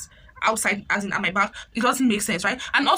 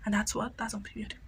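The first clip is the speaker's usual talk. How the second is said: whisper; 11 dB quieter than usual. Real, not acted. Sign-off catchphrase to viewers.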